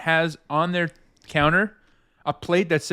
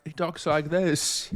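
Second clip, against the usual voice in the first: slow, softer British voice; 3 semitones lower, 5 dB quieter, slower than usual. Acted. Showing how the seventyish uncle talks now, slow British accent. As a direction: imitate an elderly British musician, unhurried. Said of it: "he's like [name] now".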